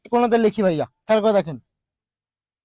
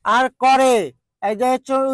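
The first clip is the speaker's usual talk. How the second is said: ordinary delivery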